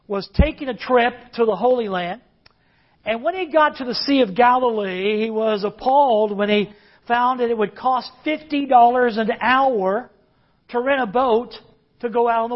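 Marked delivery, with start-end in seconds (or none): none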